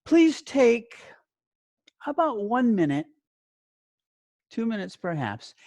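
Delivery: usual speaking voice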